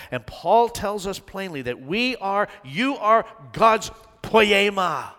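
loud, high fervent preaching; preaching at full force. Acted. Preacher pressing the point home to the church, loud and fervent. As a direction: build to the climax with fire.

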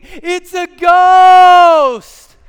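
screaming in terror, high and loud; terrified shout. Acted. Disciples shrieking at a ghost on the lake in terror. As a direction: shriek it out like you've seen a ghost.

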